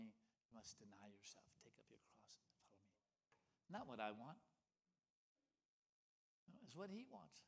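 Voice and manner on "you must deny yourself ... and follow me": hushed whisper, almost no voice. Acted. Preacher quoting Jesus to the congregation in a whisper. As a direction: breathe the words of Jesus like a secret.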